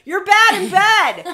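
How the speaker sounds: laughing mock line. Partner weeping below, said through laughter. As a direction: giggle out the cruel line. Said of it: "stand over them and go".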